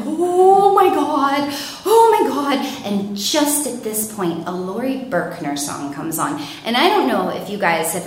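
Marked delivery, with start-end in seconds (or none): 0.1-2.6 high, loud, drawn-out wail; panicked cry. Retold or imitated. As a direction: stretch it out in rising dread. Truck swerving around them.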